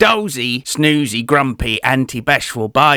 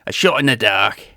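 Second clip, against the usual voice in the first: usual speaking voice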